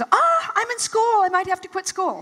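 high, loud panicked wail; panicky. Retold or imitated. Young wife hearing of the layoff, panicky and high. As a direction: squeal in self-centered alarm. Her panicked self-centered reaction.

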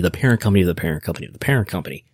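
rapid-fire run of repeats, tongue-in-cheek; rattled off fast. Real, not acted. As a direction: rattle the chain off in one fast breath. Comic chain of parent companies.

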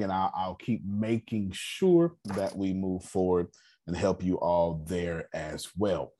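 usual speaking voice

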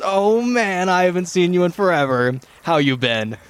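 put-on high, gushing hello to an old buddy; excited reunion greeting. Rock gnome greets a long-lost army friend, excited.

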